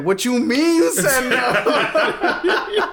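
high, loud, drawn-out outraged yell; mad trainer. Retold or imitated. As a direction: imitate a furious trainer in disbelief. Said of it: "He gets mad".

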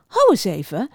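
plain reading voice

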